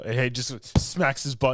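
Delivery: ordinary talk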